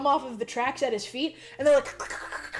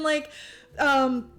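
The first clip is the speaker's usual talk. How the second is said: ordinary talk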